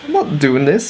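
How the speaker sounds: exasperated mock disbelief; incredulous refusal.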